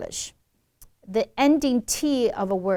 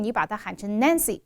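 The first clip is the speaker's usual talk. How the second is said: ordinary talk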